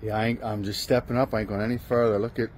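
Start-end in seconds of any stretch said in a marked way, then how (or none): none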